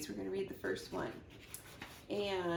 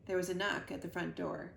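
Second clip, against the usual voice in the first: ordinary delivery, nothing marked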